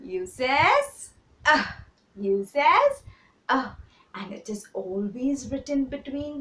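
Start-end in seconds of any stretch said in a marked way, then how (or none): 0.0-3.7 short "uh" said clearly after "U says"; teacher modelling "uh"; phonics sound. Shown as a demonstration. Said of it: "what sound does the U make".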